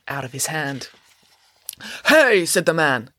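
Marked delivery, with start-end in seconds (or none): 2.1-2.5 loud, high protesting "hey"; indignant shout. Acted. Man protests as his paper is snatched, shouting. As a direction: object sharply to being robbed.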